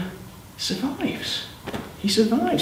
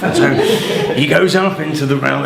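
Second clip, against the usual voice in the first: ordinary delivery, nothing marked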